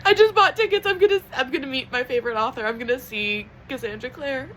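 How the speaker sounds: crying with joy. Real, not acted. Fan announcing she'll meet her favorite author, crying happily. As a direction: blurt it out high, overcome with happy tears. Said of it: "because I'm crying".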